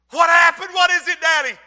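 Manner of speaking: put-on child asking daddy about the scream, high and alarmed. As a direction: jolt awake in fear.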